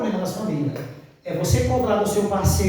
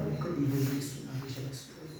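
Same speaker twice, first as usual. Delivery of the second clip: quiet aside; 6 semitones lower, 11 dB quieter than usual. Retold or imitated. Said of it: "bem perto dela".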